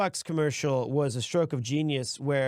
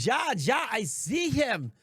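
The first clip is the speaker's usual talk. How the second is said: much higher mock German caller; imitating the caller's strange German accent, higher; 7 semitones higher than usual. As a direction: parrot the caller's odd accent. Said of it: "Sounded like a".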